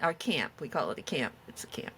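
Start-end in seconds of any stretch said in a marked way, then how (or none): 1.5-1.9 whisper. Real, not acted. Whispered aside correcting herself.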